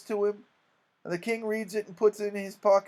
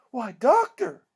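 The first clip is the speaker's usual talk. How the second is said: higher, scandalized exclamation; shocked; 6 semitones higher, 5 dB louder, slower than usual. Acted. Townsfolk reacting to the doctor laughing, shocked. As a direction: cry out in shocked reproach. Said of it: "everybody was shocked".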